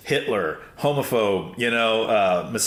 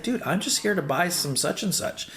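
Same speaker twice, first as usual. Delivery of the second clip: higher, lightly voiced calm guy; calm customer; 9 semitones higher than usual. Customer calmly explaining to the shouting owner, lightly voiced calm reply. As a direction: keep it easy and puzzled, lightly acted. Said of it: "He's very calm".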